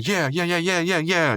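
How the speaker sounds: high, bouncy chant of yeahs; celebrating. Retold or imitated. Mordecai and Rigby high-fiving each other, high bouncy cheering. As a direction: cheer it out while trading high fives.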